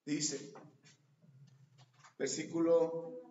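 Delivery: ordinary delivery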